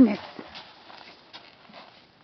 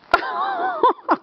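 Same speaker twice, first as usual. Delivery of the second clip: high giggle. Real, not acted. Laughing at horse.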